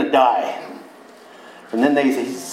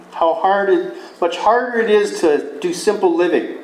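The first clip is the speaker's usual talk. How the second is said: normal talking voice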